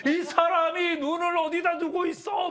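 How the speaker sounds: high, drawn-out road-accident shouting; mock angry yell. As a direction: yell like an outraged driver, hammed up. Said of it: "막 소리 지르잖아요". (과장되게 따지는 고함 흉내)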